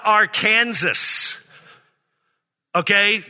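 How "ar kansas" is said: "Arkansas" said ar-KANZ-us, slowly; wrong state pronunciation. Shown as a demonstration. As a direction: say "Arkansas" like "our Kansas". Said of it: "it's not Arkansas".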